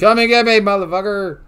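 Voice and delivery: high, loud challenging yell; taunting shout. Real, not acted. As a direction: dare them at full volume.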